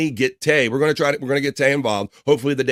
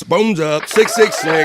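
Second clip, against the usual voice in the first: hype shout; 6 semitones higher, 7 dB louder, slower than usual. Real, not acted. Hyping up a chat regular.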